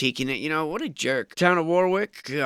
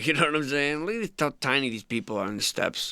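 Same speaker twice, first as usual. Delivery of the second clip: normal talking voice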